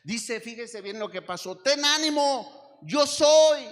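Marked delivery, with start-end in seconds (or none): 1.6-3.7 loud, high and slow declaration; booming proclamation. Acted. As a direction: ring it out like a voice over the storm. Jesus proclaimed over the storm.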